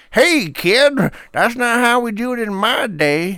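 put-on high, loud cranky old-timer. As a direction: scold the kid like a grumpy elder. Imagined older generation scolding kid.

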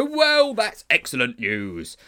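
loud, slow booming delight; hearty teacher's boom; frighteningly enthusiastic. Acted. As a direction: burst out with overbearing enthusiasm. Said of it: "burst into frighteningly enthusiastic laughter".

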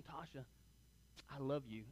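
soft, hushed first I love you; tender confession. Retold or imitated. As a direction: say it gently and nervously, like the first time. First telling girlfriend he loves her.

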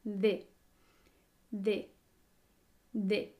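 De with the tongue tip between the teeth; Spanish D demo. Shown as a demonstration. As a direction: repeat De with a soft dental D. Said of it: "la punta de la lengua ligeramente entre los dientes".

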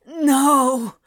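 appalled, drawn-out protest; horrified gasp. A narrator's reading. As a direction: gasp it out in dismay. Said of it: "Crowley gasped, horrified".

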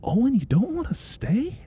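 high, gentle celebrity impression; Owen Wilson. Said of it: "he's always confused".

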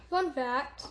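ordinary talk

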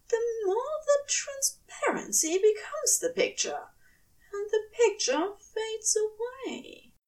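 high angel voice on the edge of tears; tearful angel. Acted. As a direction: let the angel's voice crack as memory returns. Aziraphale voice, tearful.

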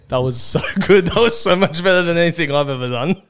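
laughing through praise; can't stop laughing. Real, not acted. Cracking up at the intro.

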